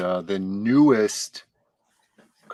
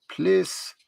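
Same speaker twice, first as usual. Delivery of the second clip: reading chat voice; 9 semitones higher, slower than usual. Retold or imitated. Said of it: "Snuggy Junior says".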